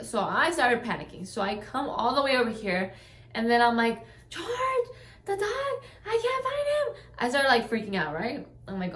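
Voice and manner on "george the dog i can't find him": high, panicked cry to her man; panicked plea. Retold or imitated. Running back to tell her man the dog is gone, high and panicked. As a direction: voice jumps up in alarm, words tumbling out.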